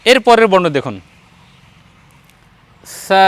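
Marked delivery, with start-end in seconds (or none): 3.0-3.3 সা said softly, with a long hissy consonant and vowel; soft letter sound. Shown as a demonstration. Teacher has learner repeat the letter, soft pronunciation of সা. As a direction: say সা gently, drawn out. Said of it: "নরম করে বলুন".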